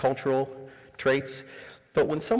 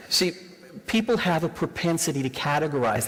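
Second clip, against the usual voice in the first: usual speaking voice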